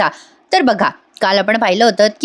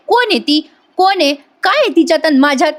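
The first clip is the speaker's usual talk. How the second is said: higher-pitched mock scolding of the boy; Payal scolding; 6 semitones higher than usual. Payal jealously scolding the garage boy, higher mock scold. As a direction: demand answers like a jealous girl. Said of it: "ओरडायला लागते, झापते आणि म्हणते".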